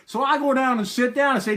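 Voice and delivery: ordinary talk, nothing marked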